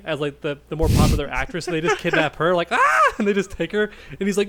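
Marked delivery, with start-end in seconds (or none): 2.7-3.1 short high yelped scream; mock scream. Kidnapped actress cries out as they grab her, mock scream. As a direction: play a sudden high shriek.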